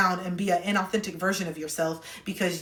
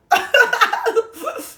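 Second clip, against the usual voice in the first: high laugh; 8 dB louder than usual. Real, not acted. Advice host laughing at her own jab at mom. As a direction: burst of high-pitched laughter.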